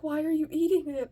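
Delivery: high, loud, fast demand; exasperated mother. Retold or imitated. Mother to kid eating the wrong food, exasperated. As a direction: fire the question at your child. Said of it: "She's like".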